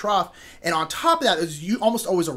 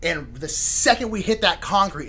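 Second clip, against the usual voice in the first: ordinary delivery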